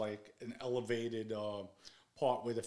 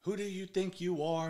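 retold scolding, pitched up; father going off; 6 semitones higher than usual. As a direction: imitate an outraged dad laying into his son. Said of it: "he's going off on me".

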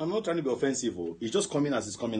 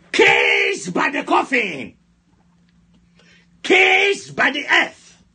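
full-force prayer shout; 9 semitones higher, 7 dB louder than usual. Real, not acted. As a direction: shout the prayer out like battle orders.

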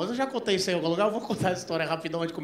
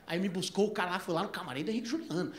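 ordinary talk

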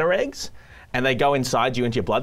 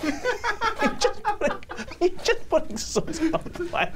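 high-pitched, laughing protest; helpless laughing; 3 dB quieter than usual. Real, not acted. Host to a guest putting sauce on the plate, laughing helplessly. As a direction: squeal it through laughter.

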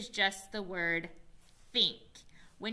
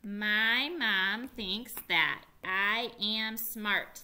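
slow choral reading; 3 dB louder, much slower than usual. Shown as a demonstration. Teacher leading the class in a slow choral read. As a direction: read slowly word by word. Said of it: "fluency sweeps".

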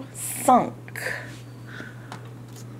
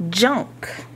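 normal talking voice

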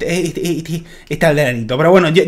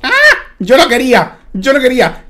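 startled cry; 7 semitones higher, 5 dB louder than usual. Real, not acted. Mob attacks after pearl.

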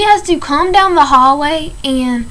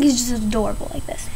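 fond whisper; 6 dB quieter, faster than usual. Real, not acted. Doting on the cat on camera.

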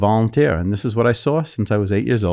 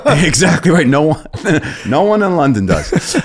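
"Exactly right" laughed out, louder and higher; laughing; 7 semitones higher, 5 dB louder than usual. Real, not acted. Guest laughing about sounding like Brooklyn. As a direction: laugh while agreeing.

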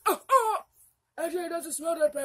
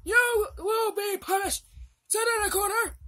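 hammed-up grandpa shouting a punishment; stern scolding; 3 dB louder than usual. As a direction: order her to the corner.

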